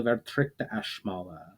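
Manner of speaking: plain reading voice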